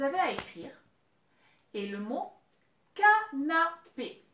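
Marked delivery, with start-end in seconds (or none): 2.9-4.2 "canapé" slow and loud, ca, na, pé; syllable by syllable. Shown as a demonstration. Teacher dictating a three-syllable word, slow separated syllables. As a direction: split "canapé" into three clear beats. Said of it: "Il y a trois syllabes".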